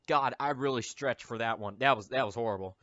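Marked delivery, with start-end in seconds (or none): none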